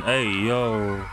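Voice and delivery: ordinary talk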